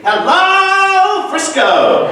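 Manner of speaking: high, loud, brassy show-tune belt of a greeting. Retold or imitated. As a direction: belt it like a Broadway diva. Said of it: "this soundbite of [name] saying".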